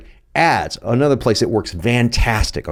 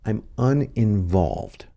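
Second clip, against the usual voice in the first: quoting Don Draper; 3 semitones lower, 6 dB quieter, much slower than usual. Retold or imitated. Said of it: "He said".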